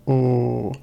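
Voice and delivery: low sustained "ooh". Shown as a demonstration. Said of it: "I could lower the, the pitch".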